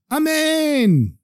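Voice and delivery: very high, long amen, falling at the end; shouted amen. A narrator's reading. Cattle dealer sealing the rabbi's blessing, shouted amen. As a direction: sing out a high, stretched cry. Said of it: "And Velvel shouted".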